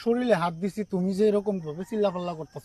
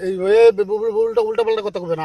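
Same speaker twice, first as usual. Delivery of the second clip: loud, stammering angry yell; angry shout; 2 semitones higher, 8 dB louder than usual. Acted. Man yelling at the girl to stop talking nonsense, loud and stammering. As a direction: shout her down, tripping over the words. Man fends off girl's accusations.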